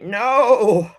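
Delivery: whiny plea that fades to a murmur; pleading protest. Retold or imitated. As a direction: beg the meal not to end, winding down. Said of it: "and I'm like".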